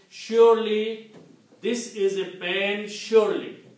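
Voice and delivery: teacher's slow, loud example of double emphasis; emphatic surely. Shown as a demonstration. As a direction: hammer each "surely" to prove the point. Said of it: "if I say".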